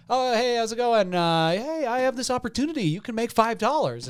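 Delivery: high-pitched mock salesman. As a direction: pitch it high, like a door-to-door seller.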